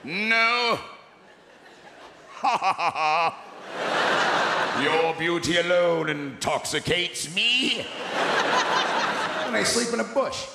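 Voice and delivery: put-on theatrical English accent, drawn out; hammy English suitor with a stagey laugh; Englishy accent. Drunk husband flattering his wife, theatrical English accent. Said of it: "bullshit Englishy sounding accent".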